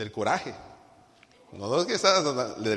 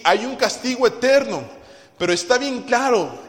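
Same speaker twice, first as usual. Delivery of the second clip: loud, high preaching at full force; 9 semitones higher, 9 dB louder, slower than usual. Acted. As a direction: thunder it from the pulpit. Predica con fuerza. (predicación a todo pulmón, fuerte y alta)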